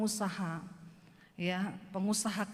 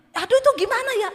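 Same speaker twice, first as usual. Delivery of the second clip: loud, higher mock fluster; flustered businessman; 12 semitones higher, 11 dB louder, faster than usual. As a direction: play the rich man at a loss, shouting. Retold flustered rich businessman. (pengusaha kaya yang bingung, berseru)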